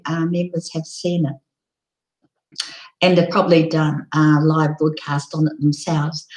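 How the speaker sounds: ordinary talk, nothing marked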